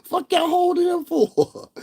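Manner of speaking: very high, slow squealed demand; mock pleading. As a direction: squeal it out, laughing. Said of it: "I was like".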